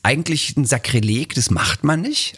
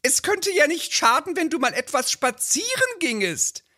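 hohe, drängende Ehefrauenstimme; nörgelnde Ehefrau; 12 semitones higher than usual. Acted. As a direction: den Mann antreiben. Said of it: "so ein Lieblingstext". (Ehefrau drängt ihren Mann, hohe Stimme)

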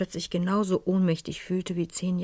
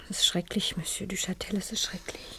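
faint whisper; 2 semitones higher, 5 dB quieter than usual. Acted. Madame near collapse at the news.

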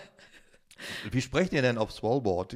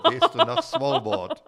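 laughing; 6 dB louder, much slower than usual. Real, not acted. Laughing through joke.

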